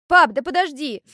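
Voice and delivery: high-pitched, impatient whine; irritated. A narrator's reading. Girl putting off her father, irritated. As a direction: brush off an interruption, voice jumping up. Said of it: "Девочка раздражённо сбросила с плеча руку".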